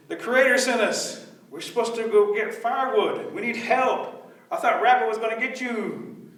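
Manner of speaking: disguised rabbit. Acted. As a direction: plead for help in a put-on small voice.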